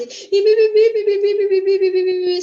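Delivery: loud, high mock nagging babble; nagging chatter. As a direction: imitate a nagging wife who never stops dripping like a leak. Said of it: "Sabe aquela mulher rixosa, aquela goteira?".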